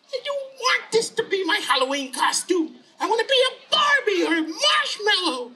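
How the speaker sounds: put-on very high, whining child voice; squeaky, indignant boy; protesting little boy. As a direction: wail it like a kid refusing a costume. Tommy refuses the costume.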